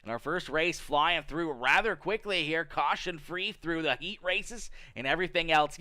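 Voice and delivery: ordinary talk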